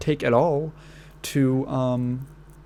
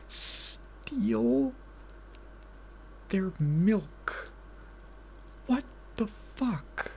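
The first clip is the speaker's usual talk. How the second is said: high, softer, slow incredulous gasp of words; stunned disbelief; 5 semitones higher, 9 dB quieter, much slower than usual. Real, not acted. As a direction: let the disbelief hang high and hushed. Disbelief at the milk theft.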